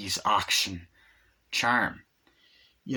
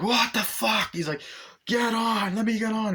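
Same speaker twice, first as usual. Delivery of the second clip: mock alarmed shout; 11 semitones higher than usual. Acted partner during bus chase.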